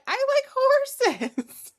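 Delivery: very high, drawn-out mock whine; hammed-up, loud little plea; retold childish protest. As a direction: squeak it slowly in self-defence. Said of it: "And I was like".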